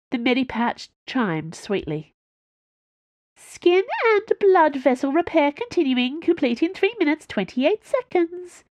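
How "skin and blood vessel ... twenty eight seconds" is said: put-on much higher, childlike device announcement; sweet high robotic baby voice; babyish machine voice. A narrator's reading. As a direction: chirp the readout like a baby-voiced gadget. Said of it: "it announced in a babyish voice".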